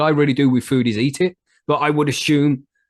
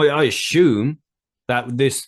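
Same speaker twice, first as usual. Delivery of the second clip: usual speaking voice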